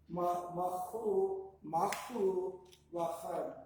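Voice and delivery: machu lachan spelled out very slowly; teacher correcting machu lachan, slowed right down; slow syllable demo. Shown as a demonstration. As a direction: break Ma-, machu into slow parts. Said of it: "Like hun".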